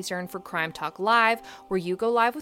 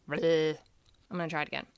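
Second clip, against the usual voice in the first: ordinary talk, nothing marked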